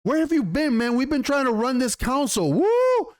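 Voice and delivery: high-pitched mock confrontation; not holding back. Son confronts his returning mother, imitated outburst. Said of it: "this kid does not hold back".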